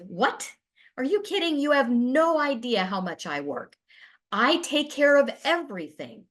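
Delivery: lightly acted indignant partner, pitch jumping up; indignant retort. Partner fires back about how much they work, mock indignant. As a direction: push back in half-disbelief at the accusation. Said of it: "which might be met with".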